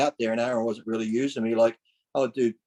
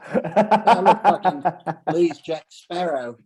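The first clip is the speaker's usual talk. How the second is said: laughing; 5 semitones higher, 6 dB louder, much slower than usual. Real, not acted. Joking about eye patch.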